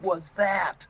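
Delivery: high-pitched startled question, retold; imitating someone taken aback, pitch jumping up. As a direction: act out a stunned camper reacting. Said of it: "her eyes got big".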